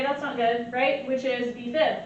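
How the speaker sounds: ordinary talk, nothing marked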